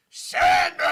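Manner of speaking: huge high-pitched drawn-out holler; calling out. Retold or imitated. Teenage fan re-enacted yelling to Adam Sandler, loud holler. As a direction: bellow the nickname down the block, stretching it long. Yelling to a star across street.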